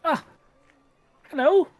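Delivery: high, polite little greeting; gnome shopkeeper voice. Acted. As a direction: greet the customer like a small, prim gnome. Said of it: "you just see this individual say".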